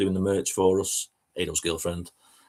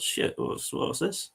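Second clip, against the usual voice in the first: unvoiced alarmed whisper, rising at the end; startled whisper; 7 semitones higher, 7 dB quieter, much slower than usual. Seeing a stranger on the settee, a frightened whisper. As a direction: gasp it out under your breath. Spots someone in living room.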